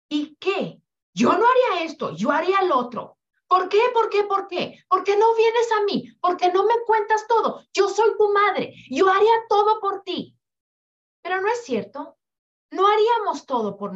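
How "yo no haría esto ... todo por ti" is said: loud, high, fast rapid-fire scolding; exasperated mother. Retold or imitated. Mother demanding her child tell her everything, loud and fast. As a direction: fire off the questions like a hurt, angry mom. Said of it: "Todo es juzgar".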